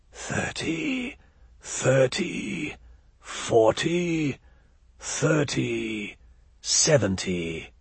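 eerie breathy whisper of an inhuman thing. Acted. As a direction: whisper the prices like a cold otherworldly being. Shadowy inhuman being names prices.

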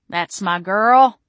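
slow, loud, proud cheer; gleeful congratulation, stretched out; crowing. A narrator's reading. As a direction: crow it with drawn-out approval. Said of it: "Dick crowed".

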